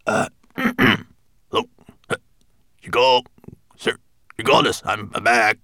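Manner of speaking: high, loud little dwarf calling out; nervous dwarf. Acted.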